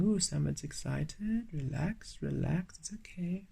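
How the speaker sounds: hushed, softly voiced reassurance; spirits' soothing aside. Spirits calming an excited reader, soft soothing hush. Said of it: "I can feel spirits saying".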